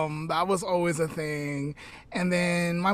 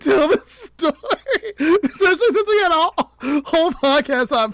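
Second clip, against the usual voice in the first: much higher, louder, rushed half-cry of dismay; flustered laughing wail; laughing panic; 9 semitones higher, 9 dB louder, much faster than usual. Real, not acted. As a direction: squeal through the embarrassment. Panicking about unlearned pronunciations.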